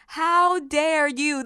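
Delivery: slow, drawn-out rebuke; indignant challenge. Acted. Preacher asking the enemy, slow and indignant. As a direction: stretch each word at the accuser. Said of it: "This is what I've been asking the enemy".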